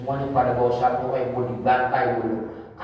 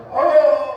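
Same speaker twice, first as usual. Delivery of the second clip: loud, high "Oh!" of pain; cry of pain; 13 semitones higher, 9 dB louder than usual. Acted. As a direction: cry out as if being sawn, acted. Acting Zakaria's cry of pain.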